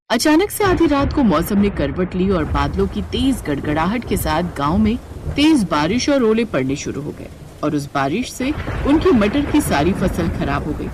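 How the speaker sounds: usual speaking voice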